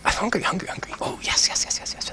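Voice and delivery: high hungry voice fading to a whisper; hungry creature whisper. Acted. Cave voice whispering greedily to the rogue. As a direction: drool over every yes.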